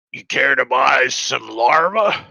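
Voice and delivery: put-on loud old hobgoblin peddler. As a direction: hawk it loudly, words drawn out. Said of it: "he's old. He's got an old beard".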